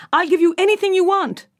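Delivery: high, loud plea for mercy; desperate cry. A narrator's reading. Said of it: "cried the king desperately".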